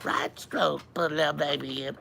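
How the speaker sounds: high, slow, warbling mock rap; sing-song parody of a rapper; high drawn-out parody rap. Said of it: "you're like".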